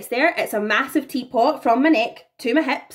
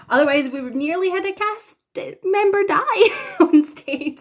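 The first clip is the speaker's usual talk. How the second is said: laughing through the words, voice jumping up; 9 semitones higher than usual. Real, not acted. Telling viewers about the noose box, laughing. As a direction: giggle as you tell how close it came. Laughing at near-disaster story.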